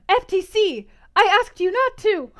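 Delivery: loud, high, playful scolding; mock outrage. Real, not acted. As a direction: yell at chat in mock betrayal. Chat spoils the book.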